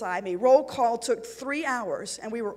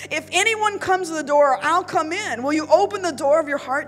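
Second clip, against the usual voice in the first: higher, louder passionate appeal; fervent pleading; 4 semitones higher, 6 dB louder than usual. A narrator's reading. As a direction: urge her on with rising fervour. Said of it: "I continued".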